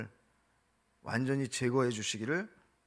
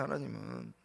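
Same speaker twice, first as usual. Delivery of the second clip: ordinary delivery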